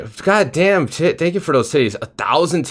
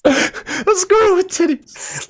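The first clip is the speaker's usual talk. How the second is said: high, slow, tearful giggling; helpless laughter; much slower than usual. Real, not acted. Host echoing the guest's squirrel image, weeping with laughter. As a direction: squeeze the words out through real laughter.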